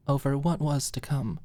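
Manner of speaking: plain reading voice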